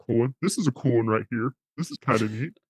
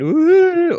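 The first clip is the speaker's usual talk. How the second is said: voice changer whoop; 9 dB louder than usual. Shown as a demonstration. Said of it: "would it get annoying if I went".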